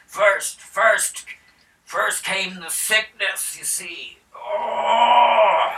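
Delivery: high rustic voice, slow in places, rising to loud cries; a wounded farmer telling his woes; hammed-up peasant tale; frightened peasant. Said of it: "the guy's like".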